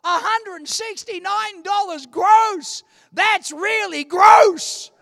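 high, loud, slow indignant shout; mad at God. Retold or imitated. Preacher retelling shouting at God over his salary. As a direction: rail at heaven over the pay. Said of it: "I was mad for a moment there".

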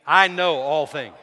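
loud, slow, emphatic declaration; like you mean it. Shown as a demonstration. Preacher leading congregation to repeat it, loud and convinced. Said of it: "say it like you mean it".

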